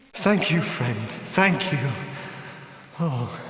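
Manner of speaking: usual speaking voice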